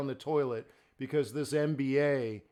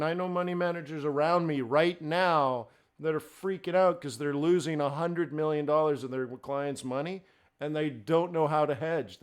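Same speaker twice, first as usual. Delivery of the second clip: ordinary talk, nothing marked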